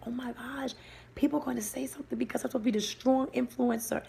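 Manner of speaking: quick whispered inner panic; whispered thought. Retold or imitated. Recalling her panic while crying on stage, whispered. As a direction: hush it like a worried thought.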